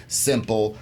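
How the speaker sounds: ordinary delivery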